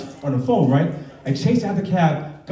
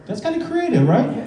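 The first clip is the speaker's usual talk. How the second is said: mock innocent; 9 semitones higher than usual. Dad defends daughter to teacher.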